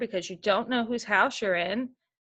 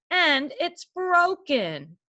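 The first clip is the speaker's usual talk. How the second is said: higher and very slow, mild mock sob; baby bear crying; 6 semitones higher, much slower than usual. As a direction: drag it out like a heartbroken cub. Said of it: "cried Wee Baby Bear".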